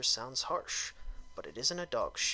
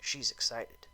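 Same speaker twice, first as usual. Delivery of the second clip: ordinary talk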